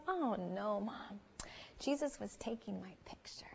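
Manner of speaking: put-on soft, whispery child's reply; imitating a child, quiet and airy; hushed little girl. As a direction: drop to a near whisper for the innocent punchline. Daughter's serene punchline reply.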